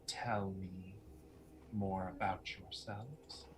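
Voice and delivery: hushed, near-whispered coaxing; quiet, insinuating invitation; beguiling enchantress. Acted. Game master voices Lucretia.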